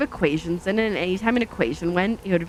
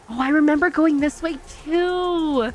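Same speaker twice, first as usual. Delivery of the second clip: high, delighted burst of remembering; sudden high-pitched excitement, stretching the last words; excited recognition; 7 semitones higher than usual. Real, not acted. Driver spots a road they remember, excited recognition.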